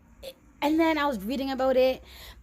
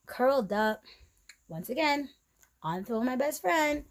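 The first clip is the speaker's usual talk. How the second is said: ordinary delivery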